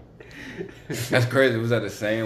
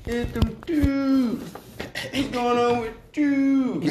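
goofy voice; 12 semitones higher than usual. Pretend. Goofy put-on voice bit.

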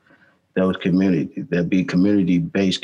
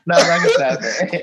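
mock scolding; 10 semitones higher, 5 dB louder, slower than usual. Repeats the mock line.

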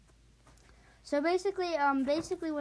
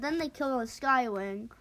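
usual speaking voice

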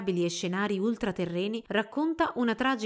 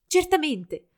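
bright raised reply, louder; happy assent; 8 semitones higher, 6 dB louder, slower than usual. A narrator's reading. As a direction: accept the invitation gladly. Happy reply to dance invitation.

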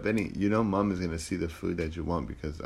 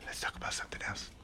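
frantic whisper; 14 dB quieter, faster than usual. Real, not acted. Hiding talk as Mom arrives.

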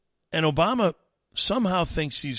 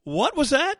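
surprised; 12 semitones higher, 5 dB louder than usual. Real, not acted. Reacting to garbled clip.

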